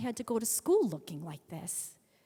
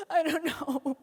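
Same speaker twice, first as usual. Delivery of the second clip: high, loud hammed-up wail; mock crying; 7 semitones higher, 7 dB louder, slower than usual. Teen confessing to her mother, hammed-up crying. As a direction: bawl it like a teenager. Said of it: "I'm crying".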